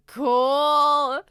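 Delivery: loud, wowed exclamation; shouted in admiration; loud cool. Acted. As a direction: blurt it out, totally impressed. Reacting to superhero drawings.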